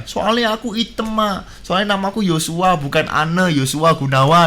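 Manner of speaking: normal talking voice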